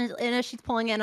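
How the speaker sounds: ordinary delivery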